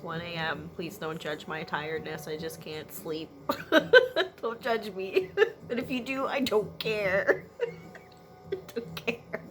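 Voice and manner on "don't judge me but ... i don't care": high, giggly defiance; real laughing. Real, not acted. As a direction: laugh right through the words. Laughing at her own tiredness.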